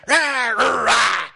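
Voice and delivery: very loud roaring shouts; demon roars. Pretend. Preacher roars as demons greeting a traveller, very loud. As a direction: bellow like a possessed man.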